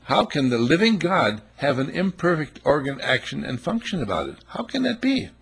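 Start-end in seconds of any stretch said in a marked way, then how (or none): 4.5-5.3 mock disbelief. Mock incredulous question.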